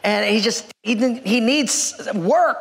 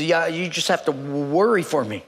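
put-on low, stammering old man; shaking elderly man; 6 semitones lower than usual. Old shop owner to job seeker, shaky stammer. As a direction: stutter haltingly on the first sounds. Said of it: "this man is kind of shaking".

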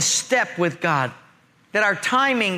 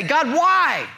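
higher, drawn-out protest; upset; 8 semitones higher, slower than usual. Acted. Believer questioning God after a setback, upset. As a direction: complain upward in frustration. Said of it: "sometimes we get upset and say".

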